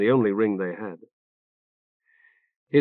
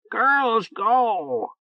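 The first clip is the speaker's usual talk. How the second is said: much higher, rasped like a talking bird; hoarse bird voice; 10 semitones higher than usual. A narrator's reading. Orb the bird reporting to Orc, hoarse squawk. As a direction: croak it out like a parrot reporting. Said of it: "reported hoarsely".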